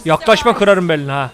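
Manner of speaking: ordinary delivery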